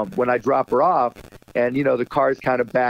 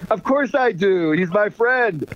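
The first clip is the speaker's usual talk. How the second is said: higher-pitched, drawn-out joke payoff; hammed-up punchline; 6 semitones higher, slower than usual. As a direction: deliver the corny punchline with relish. Acts out his joke's punchline.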